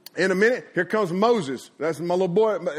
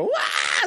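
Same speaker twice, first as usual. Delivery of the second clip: high-pitched "wah" squeal; sliding kid's yelp. Retold or imitated. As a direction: imitate a kid flying across the floor. Imitates kids flung across the floor.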